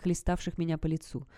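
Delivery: usual speaking voice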